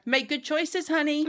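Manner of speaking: high, singsong movie-mum send-off; Jamie Lee Curtis. Acted. Mum seeing daughter off, high singsong call. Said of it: "so Parent Trap, Lindsay Lohan, Jamie Lee Curtis".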